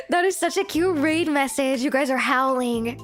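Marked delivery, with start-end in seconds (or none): none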